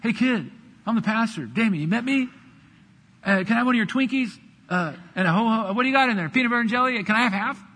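wheedling pastor. Pretend. Pastor imagines himself begging a kid for Twinkies, coaxing. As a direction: sweet-talk the kid out of his snack. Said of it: "Can you imagine seeing Pastor Damien ... stealing a kid's".

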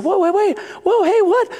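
put-on high, panicky child's protest; anxious kid. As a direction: act out rising alarm as toys vanish. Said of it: "it's getting a little anxious".

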